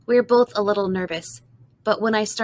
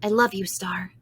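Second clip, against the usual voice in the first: usual speaking voice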